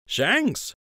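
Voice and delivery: light smirking tease; smirking friend. A narrator's reading. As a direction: needle him with a grin. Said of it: "Lund said with a smirk".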